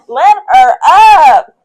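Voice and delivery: high, loud, slow order; loud, slow laughing order to the sister; laughing command. Retold or imitated. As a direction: order her off the door through a laugh.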